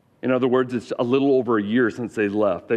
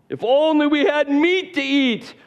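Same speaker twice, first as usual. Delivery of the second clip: high, slow, moaning whine about meat; drawn-out mock wail; whiny wail; slower than usual.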